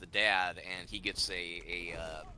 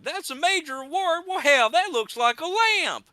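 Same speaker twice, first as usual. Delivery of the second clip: much higher, louder thick Midwest voice, "major award" rising in disbelief; incredulous Midwest accent; 7 dB louder than usual. Retold or imitated. Neighbor scoffing at the dad's prize, thick Midwest accent. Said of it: "he affects this really thick Midwest accent".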